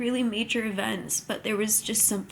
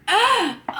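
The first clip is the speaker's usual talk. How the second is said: high sharp gasp of shock; loud gasp; 10 semitones higher, 6 dB louder than usual. Real, not acted. Viewer reacting to the baby news, gasping. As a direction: gasp as if it just hit you.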